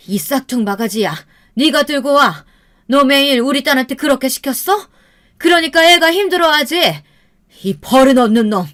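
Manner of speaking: furious mother scolding, pitch climbing high; acted angry outburst, rising to loud; explosive anger. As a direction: tear into the rude son-in-law. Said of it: "어머니는 그때 폭발하고 말았어요".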